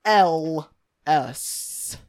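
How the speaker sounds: slow letter-by-letter dictation; spelling out. Shown as a demonstration. Reader spelling a username for co-hosts, slow dictation. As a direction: spell it deliberately, pausing after each letter. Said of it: "Can you spell it for us?".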